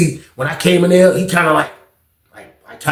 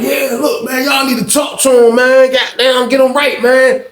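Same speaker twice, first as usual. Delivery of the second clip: hammed-up Pitts yelling at the table; imitating Pitts; 9 semitones higher than usual. Pitts urging them loudly.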